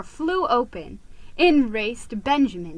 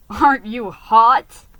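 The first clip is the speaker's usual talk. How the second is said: chuckling; 10 dB louder than usual. A narrator's reading. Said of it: "he added a chuckle".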